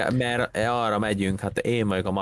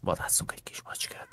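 sneaky hunting whisper; 9 dB quieter than usual. Real, not acted. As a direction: whisper it as if stalking prey. Sneaking on a cat hunt. (suttogva, lopakodva)

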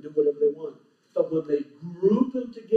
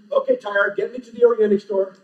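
loud, higher-pitched order to a tire; raised-voice pretend command; mock command; 5 semitones higher, 9 dB louder than usual. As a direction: call out a playful order, loud and high.

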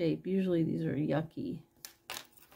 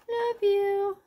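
much higher and louder, cooing; sweet repeat; 12 semitones higher, 5 dB louder than usual. Real, not acted. Seller admiring a love you ring, sweet coo. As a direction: coo it like a valentine.

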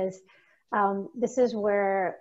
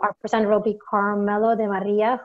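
usual speaking voice

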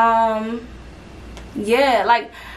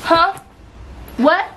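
startled disbelief; surprised; 7 semitones higher, much slower than usual. Real, not acted. Reacting to the video.

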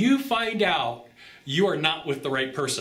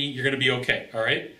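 ordinary talk

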